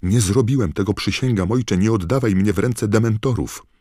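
high, loud, frantic pleading; panicked young man's cry; desperate shouting. A narrator's reading. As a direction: plead wildly as if about to be dragged away. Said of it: "krzyknął pułowowłosy młodzieniec".

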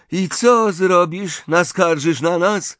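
high, drawn-out jeer; mocking. A narrator's reading. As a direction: taunt her for tattling. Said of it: "zadrwił Ron".

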